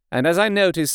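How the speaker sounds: usual speaking voice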